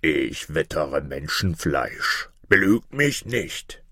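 low, slow monster bird voice; big griffin, drawing out every word; menacing griffin. Acted. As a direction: sniff out prey in a slow, threatening creature voice. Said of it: "rief er".

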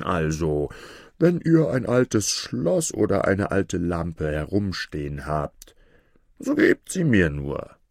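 slow, put-on trader's voice; coaxing sorcerer. A narrator's reading. Sorcerer luring the princess with a new lamp, coaxing. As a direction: play the sorcerer talking up his wares.

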